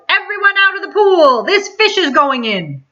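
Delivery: yelling fireman. A narrator's reading. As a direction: clear the pool!. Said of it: "The fireman yelled".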